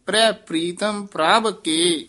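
ਪ੍ਰੈ ਪ੍ਰੀਤਮ ਪ੍ਰਭ ਕੇ, then a clear rest; full stop after. Shown as a demonstration. Teacher shows the rest after ਪ੍ਰਭ ਕੇ. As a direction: finish the phrase and rest. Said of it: "ਇੱਥੇ ਵਿਸਰਾਮ".

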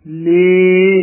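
single stressed ਲੇ; model syllable. Shown as a demonstration. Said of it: "ਇਸ ਤਰ੍ਹਾਂ ਬੋਲਣਾ ਹੈ". (ਲੇ ਉੱਚਾ ਤੇ ਸਾਫ਼)

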